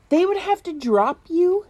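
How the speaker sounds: ordinary talk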